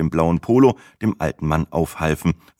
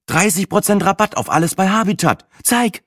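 high, eager voice grabbing for the phone; suddenly thrilled by a bargain; eager excitement; 13 semitones higher than usual. A narrator's reading. Sina excited about sale.

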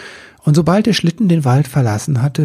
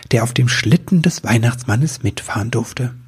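ordinary delivery, nothing marked

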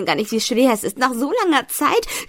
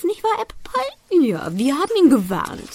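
cooing; 6 semitones higher than usual. Acted. Baby talk to her pet.

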